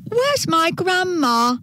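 high, slow, plaintive question; worried little girl. A narrator's reading. Girl looks around for grandma, high worried little-girl voice. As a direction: call for grandma, high and drawn out.

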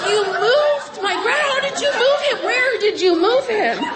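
high, rising, incredulous questions; can't believe what grandma just said; shocked disbelief. Retold or imitated. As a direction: voice jumping up in astonished alarm. Stunned reply to grandma's news.

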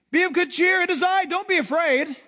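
high, loud, ringing call; rally frightened friends from afar; acted hearty shout; calling out reassurance. Jesus calling out to disciples.

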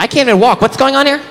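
put-on high babyish whine; baby doll talking to the little girl.

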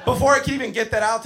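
normal talking voice

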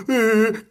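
quejido agudo de llanto fingido; llanto imitado. Retold or imitated. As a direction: imita un lloriqueo alargado. (lloriqueo de burla)

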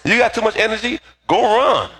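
high-pitched imitation of mama; acted-out motherly command; mother's order. Mama's order voiced in retelling.

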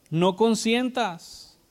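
no consientas drawn out slowly, pitched high; slow, insistent warning to the congregation; slow command. Acted. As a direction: stretch every syllable of no consientas. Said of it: "preacher's command".

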